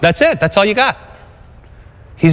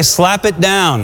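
each word slow and firm; slow call-out; much slower than usual. Shown as a demonstration. Preacher leading the church in a slow, firm chant. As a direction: lead the crowd saying it slowly. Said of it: "Somebody say".

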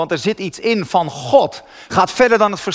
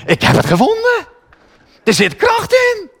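triumphant shout. Retold or imitated. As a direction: proclaim the discovery. (triomfantelijk, veel hoger en langzaam uitgeroepen)

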